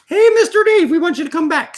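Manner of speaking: high, bright invitation; excited. Retold or imitated. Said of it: "since they said".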